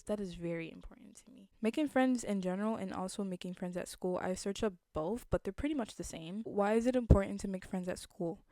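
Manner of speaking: usual speaking voice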